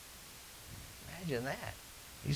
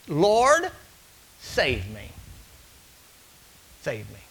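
desperate prayer; slower than usual. Retold or imitated. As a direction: cry out, then sink into begging. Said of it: "all these prayers that you pray".

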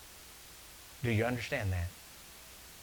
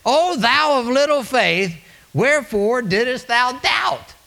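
slow, much higher, loud scolding cry; booming rebuke; 10 semitones higher, 7 dB louder, slower than usual. Acted. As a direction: thunder the rebuke from the pulpit. Preacher voicing Jesus' rebuke.

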